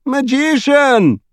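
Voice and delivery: long, very high wailing call; crying out. A narrator's reading. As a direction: stretch the word into a high, slow cry. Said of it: "cried the king".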